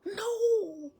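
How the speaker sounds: high, loud, tearful final cry; a last despairing wail of no; crying scream. Pretend. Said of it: "and crying".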